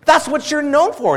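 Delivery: ordinary talk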